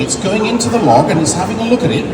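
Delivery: normal talking voice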